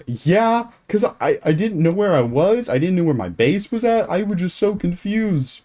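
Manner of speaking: put-on high, loud kid voice, swooping pitch; imitating flustered kid. Kid admitting he was scared and confused, hammed-up whine. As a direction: whine it like an overwhelmed little kid.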